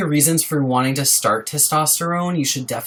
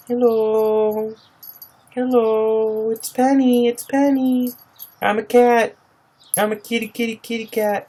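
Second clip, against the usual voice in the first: much higher cutesy pet voice; squeaky voice of the cat; 9 semitones higher than usual. Pretend. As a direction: speak for the cat in a tiny sweet voice. Speaking as her cat Penny.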